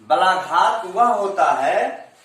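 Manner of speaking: ordinary talk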